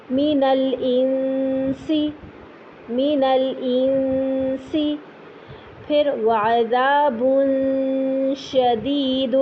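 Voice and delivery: long nasal ghunna on each noon; slow Quranic recitation. Shown as a demonstration. Teacher reciting examples for students.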